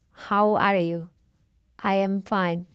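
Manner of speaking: ordinary talk, nothing marked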